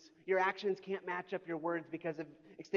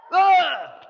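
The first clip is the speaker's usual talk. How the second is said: loud, high mummy groan; dusty mummy rasp; 10 semitones higher, 16 dB louder than usual. Pretend. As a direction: let out a loud mummy moan. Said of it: "You wanna sound like a mummy".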